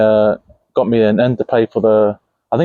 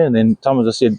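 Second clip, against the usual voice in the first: ordinary delivery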